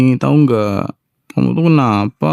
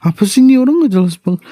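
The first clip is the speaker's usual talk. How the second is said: high, fast, louder indignant complaint; indignant outburst; 9 semitones higher, 3 dB louder, faster than usual. Acted. Wife protesting husband's takoyaki threat, high and fast.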